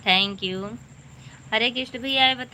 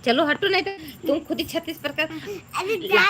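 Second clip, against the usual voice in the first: put-on fast, high childish scolding; 7 semitones higher than usual. Mother voicing her daughter shooing her away, fast high child voice. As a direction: rattle it off like a cheeky little girl.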